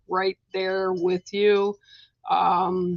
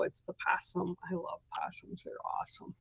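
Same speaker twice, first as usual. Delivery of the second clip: whispered aside; 2 semitones lower, 12 dB quieter than usual. Real, not acted. Aside after card reading.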